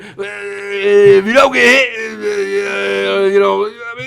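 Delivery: booming sportscaster impression; Frank Caliendo's John Madden. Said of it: "I'm doing a Frank Caliendo doing a".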